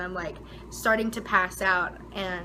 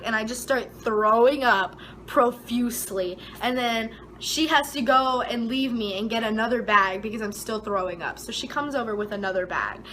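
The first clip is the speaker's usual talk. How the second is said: ordinary delivery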